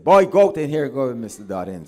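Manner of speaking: put-on a high, loud call to the boy, then settling; mother calling out. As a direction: summon the boy across the house.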